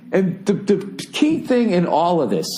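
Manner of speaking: ordinary talk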